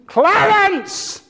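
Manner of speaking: high-pitched yell to the corpse, imitating the minister; imitated preacher's yell. Minister preaching to the corpse in the casket, yelling. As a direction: call the name out high, as if expecting an answer. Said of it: "He yells".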